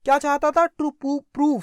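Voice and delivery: ordinary delivery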